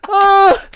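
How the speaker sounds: high, loud acted wail; panicked scream. Player runs from the minotaur, panicked scream. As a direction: scream out in hammed-up terror.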